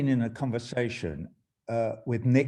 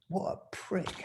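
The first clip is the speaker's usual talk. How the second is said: muttered insult; 9 semitones higher, 5 dB quieter, slower than usual. Real, not acted. Scornful aside after the reading.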